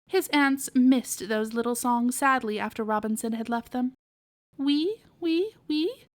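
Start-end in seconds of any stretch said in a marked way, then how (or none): 4.6-6.1 piglet answer. Acted. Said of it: "he answered".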